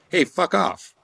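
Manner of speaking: high, snapping brush-off; hostile. Retold or imitated. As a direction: bite it off, shoving him away. Hostile entity rejects him.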